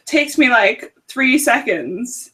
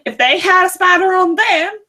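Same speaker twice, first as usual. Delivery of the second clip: put-on Southern American accent; 8 semitones higher, 4 dB louder than usual. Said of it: "I know, right?".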